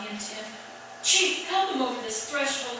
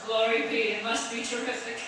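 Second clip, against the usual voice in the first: normal talking voice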